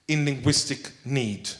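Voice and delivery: normal talking voice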